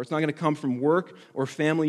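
usual speaking voice

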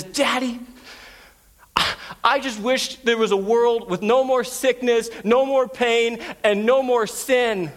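high-pitched plea, slowing at the end; imitated tearful child. Six-year-old looking up at Daddy in defeat, imitated tearful plea. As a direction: plead like a heartbroken little girl. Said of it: "bawling her eyes out".

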